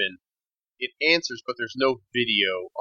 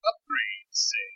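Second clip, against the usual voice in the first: intense female dealer; 5 dB quieter, slower than usual. Acted. GM plays Missus Jam.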